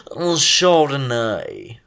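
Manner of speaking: Chardonnay drawn out very slowly, English-style; English-sounding accent. Shown as a demonstration. Attempting grape names for correctors, very slow English-sounding accent. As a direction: put on an English accent and stretch the word. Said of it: "You sounded really English".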